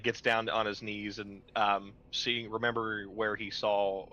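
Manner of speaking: usual speaking voice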